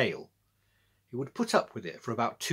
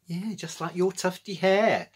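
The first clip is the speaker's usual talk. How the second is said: sniggering; 7 semitones higher, 4 dB louder than usual. A narrator's reading. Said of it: "sniggered Georgia in return".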